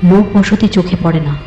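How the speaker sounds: ordinary delivery, nothing marked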